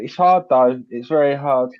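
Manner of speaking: normal talking voice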